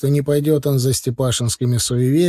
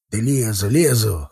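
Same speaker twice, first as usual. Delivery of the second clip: gloomy. A narrator's reading. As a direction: give in grudgingly, voice a little down. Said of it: "хмуро ответил Степашин". (хмуро, нехотя)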